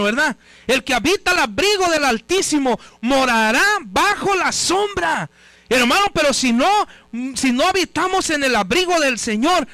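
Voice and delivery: insistent, building sermon cadence; fervent preaching. Real, not acted. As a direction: drive each phrase home to the congregation. Preaching at full force. (predicación insistente y enérgica)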